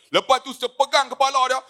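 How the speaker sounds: ordinary talk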